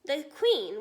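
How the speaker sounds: ordinary delivery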